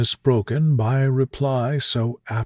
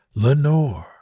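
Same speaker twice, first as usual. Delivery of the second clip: usual speaking voice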